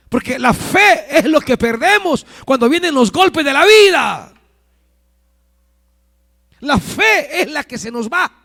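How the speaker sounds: loud, high preaching, building to a shout; predicación encendida. Real, not acted. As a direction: drive it up to full-voiced shouting. Preacher rises to full force. (predicando cada vez más fuerte, a gritos)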